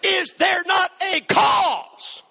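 rally the army with outrage; defiant shout. Acted. Repeated battle cry.

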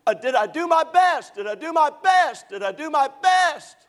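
high, loud, anguished repeated cry; hollering from a nightmare. Retold or imitated. Haunted man hollers from a recurring nightmare, high and loud. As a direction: shout it like waking from a nightmare. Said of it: "I wake myself up as I'm hollering".

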